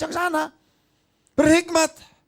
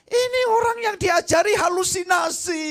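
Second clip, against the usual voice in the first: preaching shout. Real, not acted. Preacher mocks hallucinating believer.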